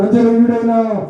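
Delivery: blind man crying to Jesus over the crowd; crying out loud. Retold or imitated. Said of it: "బిగ్గరగా మాట్లాడుతున్నాడు, నింపాతుగా కాదు".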